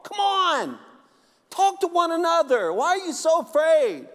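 rally them like a fired-up preacher; mock challenge. Preacher retells challenging singles.

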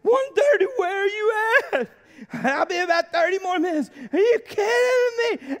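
hammed-up high wail; crying. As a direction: wail it like an abandoned husband on the phone. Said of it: "I'm sitting there and I'm crying".

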